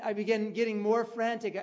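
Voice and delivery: usual speaking voice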